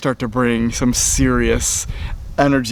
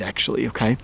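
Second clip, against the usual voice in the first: ordinary talk, nothing marked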